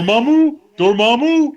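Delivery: loud, high-pitched mock movie-hero call; hammed-up high impression of a bad accent. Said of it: "His accent, though, that had to go".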